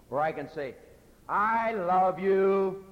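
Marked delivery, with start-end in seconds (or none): 1.3-2.8 loud, high, drawn-out brush-off; exasperated I love you. Shown as a demonstration. Preacher showing a husband fending off nagging, exasperated. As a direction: belt it slowly, meaning stop nagging. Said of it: "Stop nagging me".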